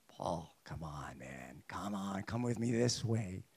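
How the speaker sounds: low, breathy whispered lure of sin; sin whispering temptation; tempting whisper. Pretend. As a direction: coax him in a hushed voice. Voicing sin's tempting call.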